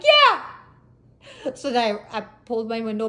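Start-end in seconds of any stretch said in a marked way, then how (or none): 0.0-0.4 high, loud snapped agreement; defiant yell. Retold or imitated. Driver answering officer's warning about yelling, defiant shout. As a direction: shout it straight back. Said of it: "you keep yelling".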